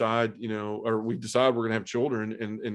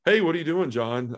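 bright, much higher, louder hello; cheery sister greeting; 6 semitones higher, 4 dB louder than usual. Retold or imitated. Sister calling John, unaware of the news, in a bright greeting. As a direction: chirp it like a sister with no idea.

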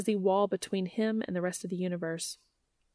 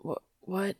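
faint, softer stammered question; weak; 3 semitones lower, 5 dB quieter, slower than usual. A narrator's reading. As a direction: say it weakly, dazed. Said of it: "he says weakly".

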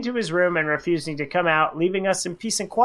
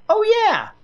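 put-on much higher, sudden childlike realization; kid voice; 5 dB louder than usual. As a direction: squeak it like a little boy catching himself. Kid remembers liking bananas.

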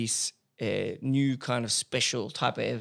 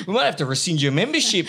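louder joking threat through laughter; laughing mock threat; 4 semitones higher, 6 dB louder than usual. Host joking to guest about membership, laughing. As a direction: fake a stern threat but keep laughing.

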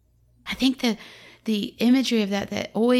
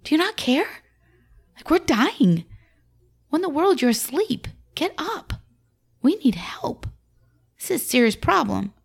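panicked disciples; 4 semitones higher than usual. Acted. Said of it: "It says".

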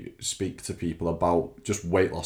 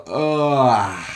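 disgusted grunt; 5 semitones higher, 8 dB louder than usual. Retold or imitated. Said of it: "I go".

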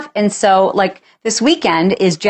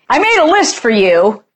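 much higher, fast, whining complaint; whiny nagging; 9 semitones higher, faster than usual. Retold or imitated. Spouse complaining partner never does anything, fast shrill whining. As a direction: rant like a fed-up nagging spouse. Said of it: "that's what I say in my head".

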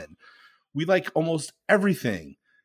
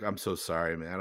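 sheepish quiet apology; 7 semitones lower, 10 dB quieter than usual. Retold or imitated. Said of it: "He's like".

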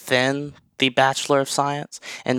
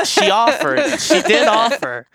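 laughing; 11 semitones higher, 5 dB louder than usual. Real, not acted. Laughing over referral fee joke.

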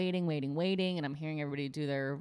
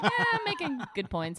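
smug; 12 semitones higher than usual. Retold or imitated. Smug retold self-quote.

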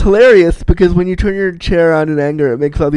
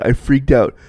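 usual speaking voice

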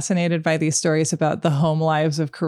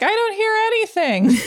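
mock innocent. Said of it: "I'd be like".

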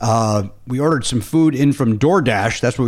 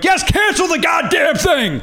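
high, slow, exasperated shout; yelling; slower than usual. Retold or imitated. Caller yelling at the restaurant worker, furious shout. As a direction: yell it out, fed up at last. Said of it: "That's what I ended up yelling".

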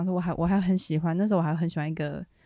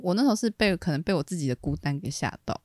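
ordinary delivery

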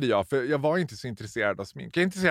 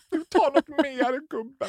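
much higher, slower, kindly sales voice; gentle conspiratorial kindness; sweet coaxing; 14 semitones higher than usual. Retold or imitated. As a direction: coo at the boy like a fond auntie. Tender to teenage boy customer.

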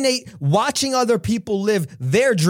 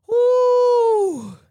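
excited woo. Real, not acted. Speaker cheering his own point, high excited whoop. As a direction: let out a hyped-up cheer. Hype shout after laughing.

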